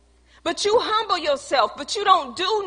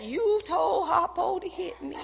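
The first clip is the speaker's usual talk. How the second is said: quieter, laughing mock Sofia; a lightly voiced angry wife; Sofia from The Color Purple; 2 semitones higher, 6 dB quieter than usual. Mocking women who confront husbands, lightly voiced Sofia. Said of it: "Be like, be like that".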